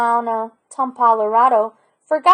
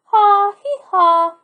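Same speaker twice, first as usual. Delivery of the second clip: high, loud braying "haw, hee, haw"; donkey bray; 9 semitones higher, 5 dB louder, slower than usual. Acted. Boy reaches donkey, donkey answers.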